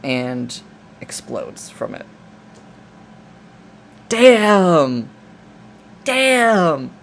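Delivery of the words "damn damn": two loud, high-pitched shouts of damn; real amazed outburst at the scene; loud exclamation. Real, not acted. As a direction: shout it out, impressed. Reacting loudly to the explosion.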